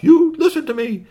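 high-pitched exasperated yell; loud, high storybook shout; yelling, screaming. Acted. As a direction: scream orders at unruly robots. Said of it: "He's yelling and screaming at the robots".

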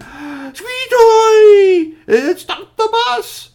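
piercing high scream, stretched out; shrieked crowd outcry; alarmed shout. Acted. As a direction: cry out in shock at full volume. Whole crowd cries out from bus.

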